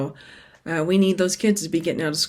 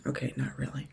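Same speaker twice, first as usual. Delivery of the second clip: quiet aside; 5 semitones lower, 14 dB quieter, faster than usual. Real, not acted. Self-correcting aside.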